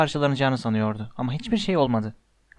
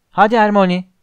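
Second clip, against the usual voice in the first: loud, high call; 5 semitones higher, 9 dB louder than usual. A narrator's reading. Boy urging Hermione to jump down, calling out. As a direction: shout up to urge a friend on. Calling friend down through hatch.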